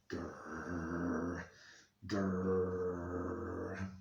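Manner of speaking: low, drawn-out dog growl; Brandy's growl. Shown as a demonstration. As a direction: growl softly and long like a dog. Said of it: "make a growling sound like Brandy".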